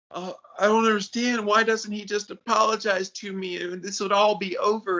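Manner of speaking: high, aggrieved and put-upon; whiny little lady. Retold or imitated. Friend imitating upset best friend, high and whiny. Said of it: "talking this little one down".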